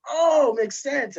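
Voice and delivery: slow, high drawn-out oh of realization; mock realization. Reader finally getting the slang, slow high realization. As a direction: stretch it out as it finally clicks. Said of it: "I was like".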